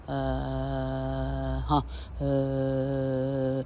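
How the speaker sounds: soft, long, level 呃; 呃 as a soft, drawn-out neutral vowel; very light schwa. Shown as a demonstration. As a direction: breathe out 呃 lightly and hold it. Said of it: "它的声音是非常的轻的，".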